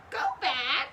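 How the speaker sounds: light squawk; parrot squawk. A narrator's reading. Polly warns Jack, mild squawk. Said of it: "squawked Polly".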